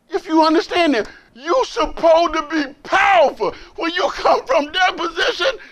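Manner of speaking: high, loud, crying out about being powerful; shouted preaching. Acted. Preacher urging the brothers to stand up powerful, shouting. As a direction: preach at full blast, then a joyful woo.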